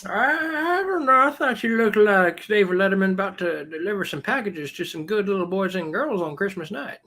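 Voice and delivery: put-on high, thin old man voice. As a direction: play a doddering old man. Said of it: "this old man thing here".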